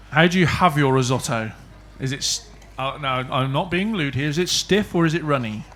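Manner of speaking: normal talking voice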